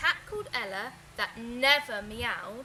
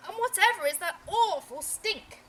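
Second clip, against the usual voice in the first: loud, higher outrage at the smell; disgusted call; 6 semitones higher, 6 dB louder than usual. Acted. Father complaining about the stink, loud. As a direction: yell out in disgust. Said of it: "called his father".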